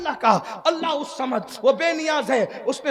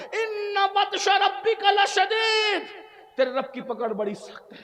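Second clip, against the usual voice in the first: tearful; 6 semitones higher, slower than usual. Acted. Tearful warning of God's punishment.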